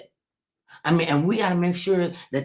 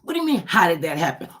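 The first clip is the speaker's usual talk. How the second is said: mock indignant woman; 5 semitones higher than usual. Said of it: "Chick come up to a fella".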